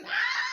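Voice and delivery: very high, soft mock scream; thin squealed scream, held; imitated scream. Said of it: "They screaming in unison".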